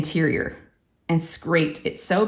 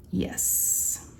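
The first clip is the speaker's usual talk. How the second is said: hushed, voiceless yes; barely there, all air and no voice; whispered confirmation; 7 semitones lower, 10 dB quieter than usual. Real, not acted. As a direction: whisper it after a pause. Confirming the shocking repair cost.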